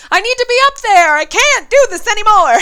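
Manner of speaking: high, loud urgent plea; excited desperation. Retold or imitated. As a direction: blurt it out, desperate to perform. Retold urgent longing.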